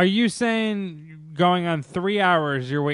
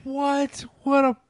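high-pitched, astonished disbelief; incredulous; 12 semitones higher, slower than usual. Real, not acted. Co-host learns the price of a signature, incredulous. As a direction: squeal it in disbelief.

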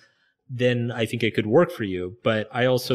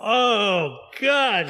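high laughing oh God; laughing groan; 12 semitones higher than usual. Real, not acted. Guest groaning at the host's joke, high and laughing. Reacting to Will Smith joke.

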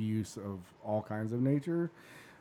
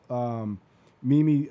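ordinary talk

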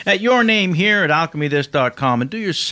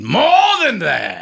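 Robert Loggia; 6 semitones higher, slower than usual. Acted. Impressionist presses the host for more time, big slow actor voice. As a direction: build to a big slow finish. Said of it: "sounds just like Robert Loggia".

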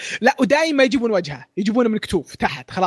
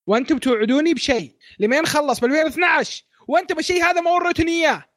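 rising, higher shout at the creators; mock outraged rant; 6 semitones higher than usual. Fan yells at manga creators over broken promises, mock outrage. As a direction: vent like a betrayed fan yelling.

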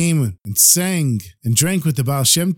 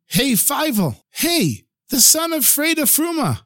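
high, carrying call across the hall; calling out after him; 12 semitones higher than usual. Acted. Townsman calls after Feivel as he flees with rolls, high carrying shout. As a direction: townsman yelling after the runaway thief. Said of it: "somebody shouts at him".

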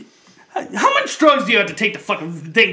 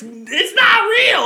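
much higher, slow mock shout; 4 dB louder, much slower than usual. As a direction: drag it out like a ranting passenger. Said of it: "He's like".

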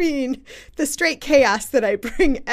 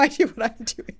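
usual speaking voice

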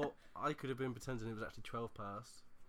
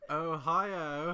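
imitating a British Ohio, higher and louder; mock British accent; 3 semitones higher, 6 dB louder than usual. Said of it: "Can you run that by me one more time?".

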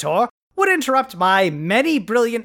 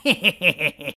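evil cackle; 2 semitones lower than usual. Acted. Villain laughs at captive.

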